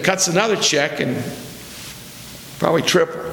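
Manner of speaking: normal talking voice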